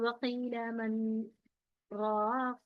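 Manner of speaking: slow, soft वकील मन, short held gap, then long राक; quiet, drawn-out recitation broken briefly before राक; saktah pause after मन. Shown as a demonstration. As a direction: hold two counts after मन before a lengthened राक. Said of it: "यानी कि दो हरकत".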